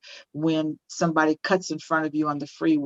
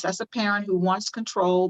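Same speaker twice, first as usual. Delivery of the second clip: normal talking voice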